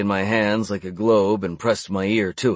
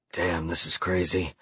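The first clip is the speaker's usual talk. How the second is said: soft whisper, lower and quieter; 4 semitones lower, 7 dB quieter than usual. A narrator's reading. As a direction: breathe it under your breath. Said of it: "I whispered".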